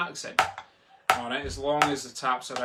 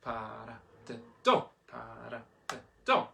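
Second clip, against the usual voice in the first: "para de do" with DO punched; hushed syllables, then a hard final "do"; accent on fourth syllable. Shown as a demonstration. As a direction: save the hit for "do". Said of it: "let's tackle accent number four".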